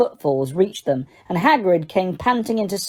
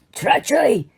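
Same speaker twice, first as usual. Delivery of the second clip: bellowed; 6 semitones higher than usual. A narrator's reading. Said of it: "he bellowed".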